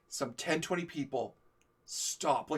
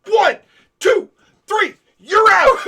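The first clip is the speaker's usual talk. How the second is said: high, loud shouted count; mock referee count; 19 dB louder, much slower than usual. A friend mocks him with a shouted knockout count. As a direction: call him out like a boxing referee. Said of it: "my friends, went".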